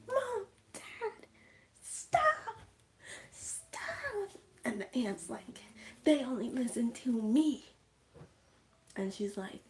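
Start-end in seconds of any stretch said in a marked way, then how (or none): 0.1-4.3 very high, squeaky-voiced begging; acted panicked plea. Kid begging parents to stop, high panicked plea. 6.0-7.7 higher, louder menacing claim; gloating aunt. Retold or imitated. Aunt to kid about parents, louder gloating boast.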